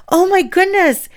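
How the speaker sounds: high, loud gasp of wonder; amazed child. Retold or imitated. Little girl seeing the fairy land on her, high and amazed.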